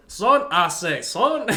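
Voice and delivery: loud, high-pitched put-on southern drawl; mock southern accent. Dad mimicking the detective's line afterwards, exaggerated southern accent. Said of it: "main detective's accent".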